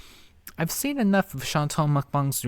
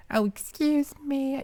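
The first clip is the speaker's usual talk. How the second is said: mocking imitation; slower than usual. Commentator mocking a play for sympathy, high-pitched imitation. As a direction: fake cough, then high, drawn-out mock apology. Mocking the woman's cough and apology.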